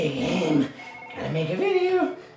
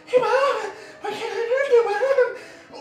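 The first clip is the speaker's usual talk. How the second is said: high playful voice; 11 semitones higher than usual. Acted. Greeting game character.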